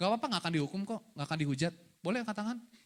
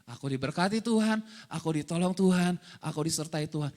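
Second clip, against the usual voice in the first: understated, low-pitched recitation sinking lower at the end; murmured declaration; 5 semitones lower than usual. Shown as a demonstration. Preacher showing hagah, to murmur, understated low declaration. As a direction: recite it evenly as if to yourself. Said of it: "Itu hagah, to murmur".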